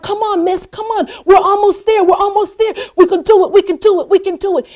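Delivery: high, increasingly loud rallying; acted push to the finish, repeated urgently; urgent cheering-on. Runner urging stranger near finish line, high urgent cheering.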